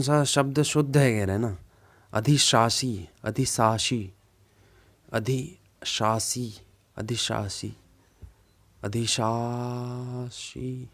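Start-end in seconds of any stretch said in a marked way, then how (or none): 2.1-10.9 slow puzzled sounding-out. Real, not acted. Teacher puzzling over a spelling for students, slowly sounding it out. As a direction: sound out अधिशासी syllable by syllable, unsure. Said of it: "मुझे भी नहीं आ रहा है समझ में".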